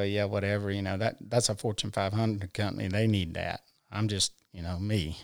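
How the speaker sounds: dismissive eye-roll. Retold or imitated. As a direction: shrug it off under your breath, retold. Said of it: "I'd just roll my eyes and go".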